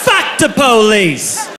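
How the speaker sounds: stretched-out defiant holler; drunken-party yell. Retold or imitated. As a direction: yell it out slowly like a rallying cry. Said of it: "I yelled".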